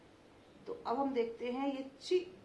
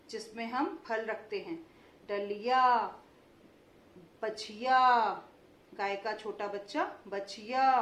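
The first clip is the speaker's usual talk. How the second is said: 1 word list read for repeating; slower than usual. Shown as a demonstration. Teacher reads word list for repeating.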